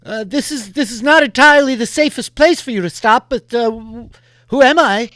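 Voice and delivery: high, loud strained voice; rider's voice. Acted. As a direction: call out over the noise. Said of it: "this rider's like".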